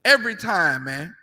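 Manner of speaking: normal talking voice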